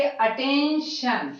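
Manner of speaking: अटेंशन drawn out, ending on a clear शन; slow demo. Shown as a demonstration. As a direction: say अटेंशन slowly, landing on शन. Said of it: "अब कैसे करेंगे देखिए". (अटेंशन धीरे, शन साफ़)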